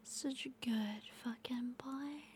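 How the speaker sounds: slow, barely voiced praise; slow hushed praising whisper; very soft whisper. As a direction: whisper it slowly, almost silent. Intimate ASMR roleplay whisper.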